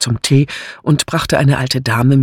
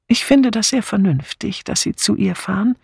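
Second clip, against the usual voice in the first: put-on higher-pitched, mild elderly lady; sensible old lady; 8 semitones higher than usual. A narrator's reading. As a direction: approve in a lightly raised ladylike voice. (ältere Dame, hohe Stimme)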